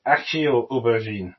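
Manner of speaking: ordinary talk, nothing marked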